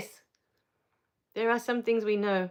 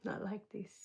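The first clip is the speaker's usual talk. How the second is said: soft, voiceless whisper of "Not like this."; 13 dB quieter than usual. Real, not acted. Rereading a viewer's comment to herself in a whisper. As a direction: breathe "Not like this." as a hush. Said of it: "reading a chat comment".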